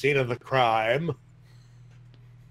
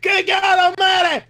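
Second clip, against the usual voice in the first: shouting; 4 dB louder than usual. Real, not acted. Outraged exclamation.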